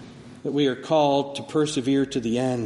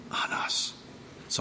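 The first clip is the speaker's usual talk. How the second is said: whisper; 8 dB quieter than usual. Acted. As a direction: drop to a bare whisper. Whispered climax.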